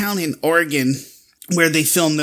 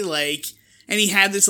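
normal talking voice

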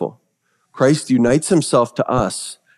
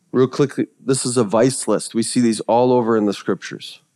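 ordinary delivery

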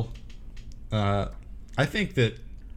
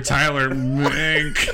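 imitating Tyler's intro; 2 semitones higher, much slower than usual. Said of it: "Why'd you say it like that?".

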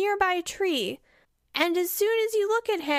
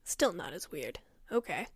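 low quiet man voice; 10 semitones lower, 8 dB quieter than usual. Acted. Forest man.

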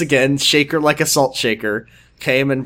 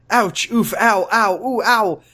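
higher, hammed-up yelps of pain; mock pain cries; 7 semitones higher, slower than usual. Amy in labor pain in the kitchen, mock yelps. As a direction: yelp through a mock contraction. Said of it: "as the pain came back".

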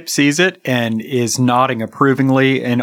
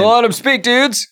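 mock heckle; 11 semitones higher, slower than usual. Crowd heckling from the audience.

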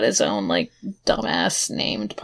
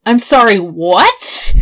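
loud baffled what; stunned, say it loud; 7 dB louder, slower than usual. Real, not acted. Baffled reaction to nonsense.

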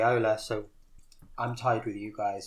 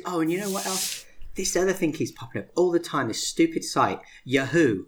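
indignant daughter; 5 semitones higher, 7 dB louder than usual. Retold or imitated. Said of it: "she goes".

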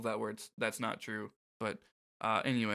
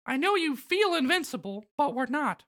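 Mickey Mouse voice; 13 semitones higher than usual. Acted. Voicing Mickey in dialogue.

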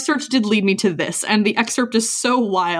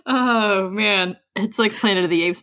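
usual speaking voice

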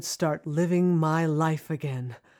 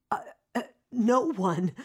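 ordinary talk

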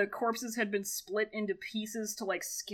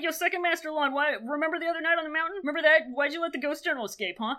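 fast, higher-pitched pestering questions; mock interrogation; 6 semitones higher, 3 dB louder, faster than usual. Podcaster voicing a sect leader quizzing second master Lan, mock nosy. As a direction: pepper him with casual questions, quickly.

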